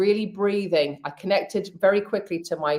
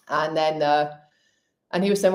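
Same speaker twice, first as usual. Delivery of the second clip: normal talking voice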